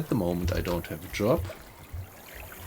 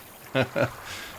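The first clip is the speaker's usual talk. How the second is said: short laugh; 6 semitones higher than usual. Real, not acted. Laughs at own dishonesty.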